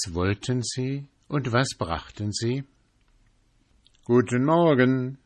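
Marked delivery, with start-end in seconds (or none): 4.0-5.2 a bright greeting called out to the gate. Acted. Travellers calling good morning to the sentry, called out. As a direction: call it cheerfully to the guard. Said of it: "riefen sie der Schildwache".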